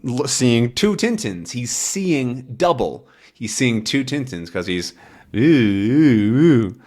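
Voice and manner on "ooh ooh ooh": put-on dizzy drunk ooh. Drunk dog seeing double.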